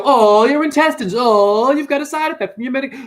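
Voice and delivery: high, loud hammed-up wail; mock sympathy. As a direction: fuss over them with exaggerated concern. Mimics fussy sympathy he could give.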